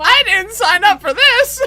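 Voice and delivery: high indignant shout; mock outraged yell. Joking outburst.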